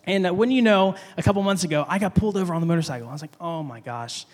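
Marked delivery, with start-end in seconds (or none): 3.4-4.2 low, hushed oh-my-gosh to himself; nervous dread. Retold or imitated. Rider pulled over by police, retold nervous dread. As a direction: mutter it with mild, retold dread. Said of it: "kinda nervous too".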